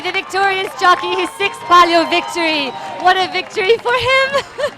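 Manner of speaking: high, jubilant shouted commentary; excited shouting. Real, not acted. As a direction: roar the victory to the crowd.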